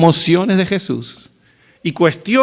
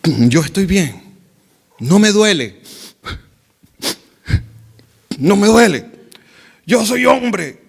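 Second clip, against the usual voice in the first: strained denial, building louder and higher; mock holding back tears; 3 semitones higher, 3 dB louder than usual. Man denying his tears to others, acted tearful insistence. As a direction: insist through pretended tears, rising as it goes. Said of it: "Y las lágrimas".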